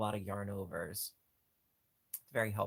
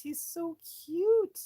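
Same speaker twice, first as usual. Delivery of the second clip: high, slow squeaky coo, louder; cutesy squeal; 8 dB louder, slower than usual. Real, not acted. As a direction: gush over it in a tiny high voice. Cooing over ox toy.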